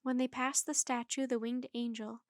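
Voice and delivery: plain reading voice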